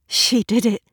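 panicked gasp. Acted. Learns police search for friend.

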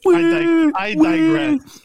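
over-the-top theatrical digression; mock dramatic. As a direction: belt it like a stage villain. Contrast with plain retake.

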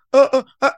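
loud caught-off-guard stammering; flustered stammer. Retold or imitated. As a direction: stumble for words, caught out. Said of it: "the person's like".

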